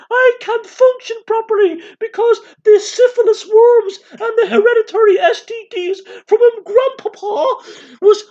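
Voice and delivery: very high, loud mock-tearful wail; hammed-up hysterical aristocrat, squealed at the top; Lady Jacinta. Aristocrat confessing her ailments to the meeting, shrieky mock wail. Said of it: "Lady Jacinta".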